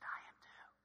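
voiceless confiding whisper; whispered confession. Acted. Gate agent confiding her faith to passenger, whisper. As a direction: whisper it like a shared secret.

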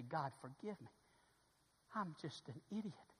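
soft, hushed plea to God; a quiet broken confession; contrite prayer. Acted. Preacher modelling repentance before God, soft hushed plea. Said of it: "fall on my face ... and say".

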